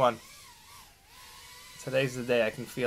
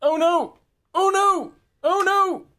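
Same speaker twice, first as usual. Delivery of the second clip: alarmed cries; 4 dB louder, slower than usual. Real, not acted.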